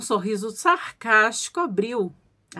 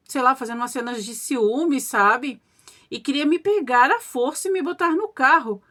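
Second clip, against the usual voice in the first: plain reading voice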